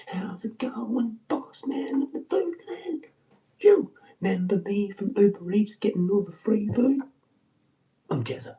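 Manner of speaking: high cheeky hello to the boss; mock friendly boss greeting.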